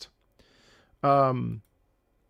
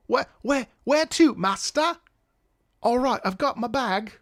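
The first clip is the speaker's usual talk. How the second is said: put-on British accent; 8 semitones higher than usual. Teacher playing a British worker hauling bags, British accent. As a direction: play an eager British porter. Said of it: "that was my attempt at a British accent".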